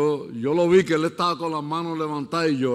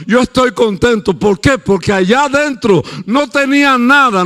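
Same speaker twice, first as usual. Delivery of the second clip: loud, high, driving sermon voice; fiery preaching; 5 semitones higher, 9 dB louder than usual. Acted. Pastor preaching to the congregation, building and loud.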